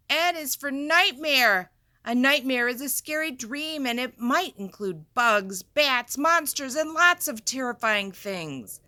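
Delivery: loud, high and dramatic; dramatic scary read. Acted. Teacher reading a scary alphabet book, loud and dramatic. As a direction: build up the nightmare with big energy.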